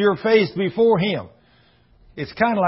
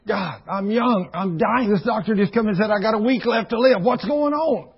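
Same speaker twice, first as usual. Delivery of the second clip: desperate cry; 6 semitones higher than usual. Retold or imitated. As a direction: cry out to God in fear. Dying man cries to God.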